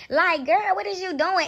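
high, incredulous call-out; a disbelieving girl what is you doing. Retold or imitated. Scene: vlogger calling out the woman on the live, incredulous.

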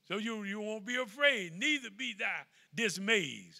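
loud preacher's drive; preaching at full force. Acted. Preacher promising the flock God stays with them, loud.

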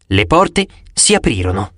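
ordinary delivery, nothing marked

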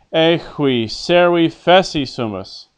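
loud, emphatic model line for the class to repeat; projected Latin model line; teacher's model reading. Shown as a demonstration. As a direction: read it out clearly as the character, with feeling. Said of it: "I'll read what the character says".

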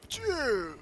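high-pitched cry of "Dude"; high exclamation. Real, not acted. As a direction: exclaim it high, dropping at the end. Exclaiming at the game.

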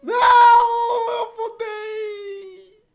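crying out, voice cracking high; anguished scream. Real, not acted. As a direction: wail it in real dismay. Character falls, player screams.

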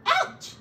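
high, loud yelp of pain; sharp high cry of pain; pained ouch. Acted. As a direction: squeal it like something pricked you. Voice in pain from cactus.

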